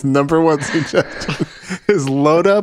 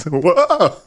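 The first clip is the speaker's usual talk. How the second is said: over eager infomercial; 5 semitones higher than usual. Acted. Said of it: "infomercial style clumsiness".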